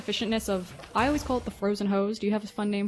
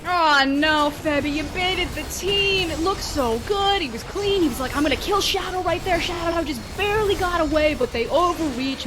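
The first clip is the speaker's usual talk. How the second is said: excited shout; 7 semitones higher, 6 dB louder than usual. Real, not acted. Teamfight play-by-play.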